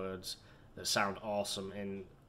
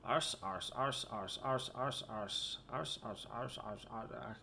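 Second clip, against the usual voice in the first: one word repeated, fading softer and softer; monotonous repetition; 6 dB quieter, slower than usual. Pretend. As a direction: drone the word over and over. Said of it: "just sit here and listen to [name] say arse".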